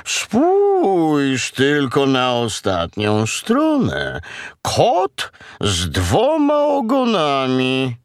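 long-drawn mooing speech; slow cow. Acted. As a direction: stretch each word out as a ponderous cow. Cow goes on speaking.

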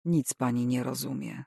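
low, soft and curt. A narrator's reading. Tenant rebuffing the nosy landlady, curt. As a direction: drop the politeness, cut her off. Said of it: "nie siląc się na uprzejmość".

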